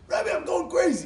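high, loud outcry; loud, exasperated wail; frantic complaint. Retold or imitated. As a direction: burst in on the rabbi at wits' end. Frantic husband comes to the Rebbe.

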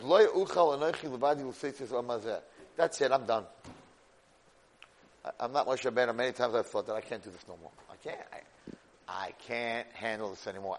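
low, subdued surrender; defeated. Retold or imitated. Moshe tells Hashem he can't go on, defeated. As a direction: give up under the load, voice dropping. Acts Moshe giving up, worn out.

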